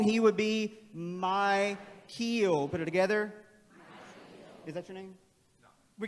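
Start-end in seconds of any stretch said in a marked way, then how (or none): 0.0-2.7 "Michael" slowly, long i as in my; long vowels. Shown as a demonstration. Teacher showing open-syllable reading, slow. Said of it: "If all of his were long".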